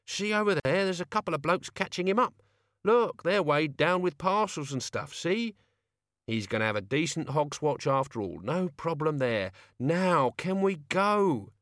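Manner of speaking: put-on pleading old man. A narrator's reading. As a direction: whine to be let off. Albert pleading.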